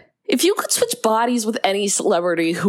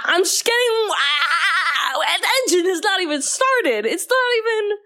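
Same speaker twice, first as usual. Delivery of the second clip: high-pitched mock outrage; hammed-up, high incredulous protest; incredulous outburst; 11 semitones higher than usual. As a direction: squeal in exasperated disbelief. Mock outrage at eleven seconds.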